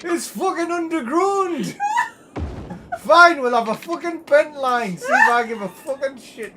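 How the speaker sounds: exasperated shout. Real, not acted. Streamer giving in on the bent line, exasperated shouting. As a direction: yell it, then give in loudly.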